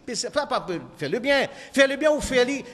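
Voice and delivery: normal talking voice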